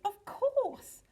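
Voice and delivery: high-pitched exclamation of discovery; sudden realisation. A narrator's reading. Mister Penguin snaps his flippers at an idea, excited realisation. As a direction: exclaim it as the answer dawns.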